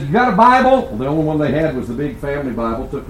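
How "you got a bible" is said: high, loud teasing question; lively challenge. Retold or imitated. Preacher challenging the uncle over purgatory, high teasing question. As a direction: toss the question up high and bright.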